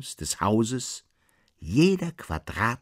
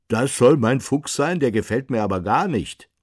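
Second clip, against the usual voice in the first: loud, higher-pitched grumbling protest; indignant outburst in a raised voice; indignant coachman; 6 semitones higher, 7 dB louder than usual. A narrator's reading. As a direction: object loudly, clearly displeased. Coachman indignant about the painted horse.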